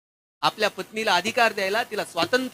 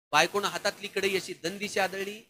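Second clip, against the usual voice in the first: ordinary talk, nothing marked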